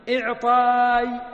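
إعطاي stretched out slowly and loudly, almost chanted; slow drawn-out demo. Shown as a demonstration. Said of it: "فهنا أعطى يعطي".

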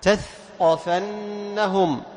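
very slow تثقفنهم, ث unvoiced before ق; modelling تثقفنهم; slow clear tha. Shown as a demonstration. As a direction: draw out تثقفنهم. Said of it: "الثاء عند القاف".

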